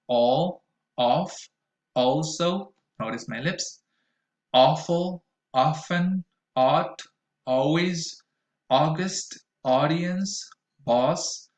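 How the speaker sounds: each word said separately, slow and clear; listen and repeat words. Shown as a demonstration. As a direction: model the aw vowel word by word for learners. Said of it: "repeat after me".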